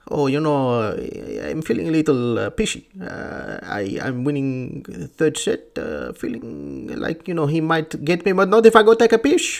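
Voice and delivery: mocking tennis-player impression, halting then building; Nadal. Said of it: "Nadal can get away with it".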